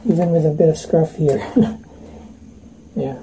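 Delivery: muffled behind face mask; speaking inside half mask; through a respirator mask. Real, not acted. Seen 1: Respirator with filter cartridges covers nose and mouth throughout.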